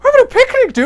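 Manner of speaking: very high, squeaky protest; hammed-up incredulous whine; mock indignation. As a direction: object in disbelief, voice shooting way up. Hero protests interrupted picnic.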